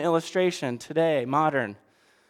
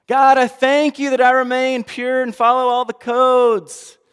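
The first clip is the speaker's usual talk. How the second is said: higher, louder proud proclamation; hammed-up pious boast; loud boastful prayer; 7 semitones higher, 8 dB louder than usual. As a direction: announce your purity to the room.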